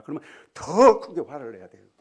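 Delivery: 더 shouted loud; shouted emphasis. Real, not acted. Shouted repeat of 더. (더를 크게 외치며)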